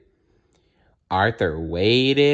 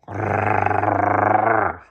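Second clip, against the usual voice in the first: dog growl. Acted. Perky growling at Arthur, dog growl. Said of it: "growled Perky".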